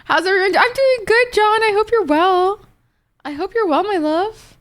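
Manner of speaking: cutesy greeting. Real, not acted. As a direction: greet them like a bubbly host. Greeting chatters sweetly.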